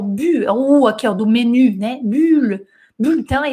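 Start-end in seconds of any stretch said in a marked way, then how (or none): 3.0-3.5 "Bulletin" with a rounded u and a silent middle e, byl-tan; French pronunciation. Shown as a demonstration. A teacher modelling "Bulletin" for beginners, French pronunciation. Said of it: "esse E quase que não fala".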